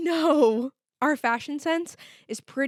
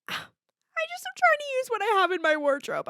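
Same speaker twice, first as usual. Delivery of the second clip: defensive sigh; 10 semitones higher than usual. Real, not acted. Defensive about her outfit.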